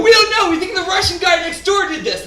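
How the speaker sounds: high, dopey bro voice with a laugh; bros answering. Retold or imitated. Bros blaming the Russian neighbor, dopey high bro voice. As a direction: sound like a clueless frat guy. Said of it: "And they go".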